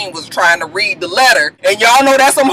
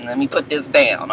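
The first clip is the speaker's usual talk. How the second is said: low, softer aside as if backing off; imitated wary reaction; 8 semitones lower, 6 dB quieter than usual. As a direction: quietly play not wanting to deal with it. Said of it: "she was just like".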